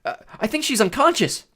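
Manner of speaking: high, hesitant hopeful call; high-pitched tentative yell. A narrator's reading. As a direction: call the news down uncertainly. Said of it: "Grover yelled".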